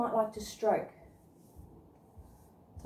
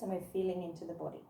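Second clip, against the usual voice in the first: softer meditation guide voice; guided meditation; 4 semitones lower, 7 dB quieter than usual. Acted. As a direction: ease them gently into their body. Leads a body scan meditation.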